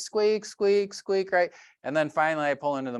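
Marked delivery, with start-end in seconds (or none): 0.0-1.3 high, squeaky car-noise imitation; imitated squeak. Describing his car's weird noise, high squeaking imitation. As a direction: squeak like a worn car part.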